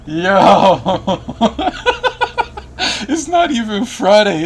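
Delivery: laughing loud and high. Real, not acted. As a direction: laugh through the words. Laughing at friend buying alcohol.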